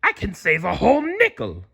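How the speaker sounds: high put-on crab boss voice; Mister Krabs voice. A narrator's reading. Mister Krabs breaking bad news, put-on voice. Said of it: "Mister Krabs explained".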